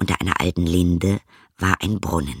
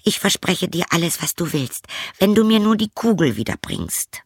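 high princess voice; storybook princess, pitched well above the narration; princess character; 9 semitones higher than usual. A narrator's reading. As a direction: plead sweetly in a high princess voice. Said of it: "sagte sie".

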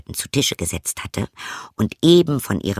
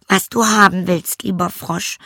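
put-on high, light girlish voice, a little dismissive; a young princess shrugging at the frog; 9 semitones higher than usual. Princess brushing off the frog, high girlish voice.

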